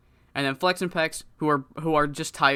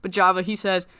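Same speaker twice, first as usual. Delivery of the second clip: normal talking voice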